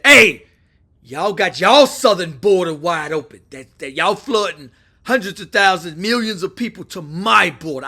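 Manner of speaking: tough, forceful ultimatum over the phone; Trump. Retold or imitated. Trump warns Mexico's president about the border, tough and forceful. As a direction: lay down the law, loud on the threats. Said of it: "People like somebody tough".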